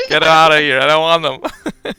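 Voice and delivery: loud laughing protest; laughing shout. Real, not acted. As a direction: yell it while cracking up. Mock outrage, laughing.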